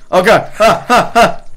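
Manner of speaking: very loud, high yelps; startled panic. Real, not acted. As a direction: cry out as if suddenly attacked. Startled by attacker in the game.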